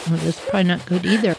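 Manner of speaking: rattled off fast under her breath; quick worried thought. Retold or imitated. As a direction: hurry the worry out. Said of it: "and I'm thinking".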